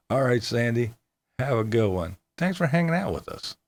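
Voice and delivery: ordinary talk, nothing marked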